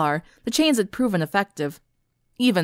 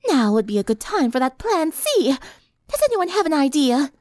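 refined lady voice; 6 semitones higher than usual. A narrator's reading. Rarity voiced.

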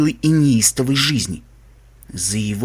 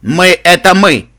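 loud, emphatic regal declaration; Royal Canterlot voice; 4 semitones higher, 7 dB louder than usual. Acted. Ghoul insists on the royal we, booming. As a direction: proclaim it with full weight. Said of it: "мы всегда говорим королевским кантерлотским голосом".

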